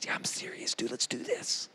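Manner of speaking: unvoiced whisper, low and soft; whispered reply. Jonathan whispering his answer to the armor bearer, hushed. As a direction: keep it secret, whisper it.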